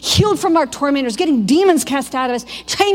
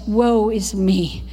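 lament of a prophet; 6 semitones lower than usual. Retold or imitated. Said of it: "you hear the prophets say".

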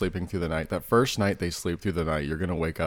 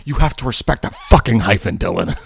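emphatic; 8 dB louder than usual. Acted. Mock rule enforcement.

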